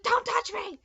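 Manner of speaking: sharp, high shout at mom; screaming don't touch me mid-labor; angry yell. Retold or imitated. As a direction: yell it to make her stop touching. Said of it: "and I was like".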